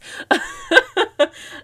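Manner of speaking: a sudden high-pitched cackle of real amusement; high burst of laughter. Real, not acted. Host cracking up at her own retelling, laughing. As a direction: laugh out loud, high and bright.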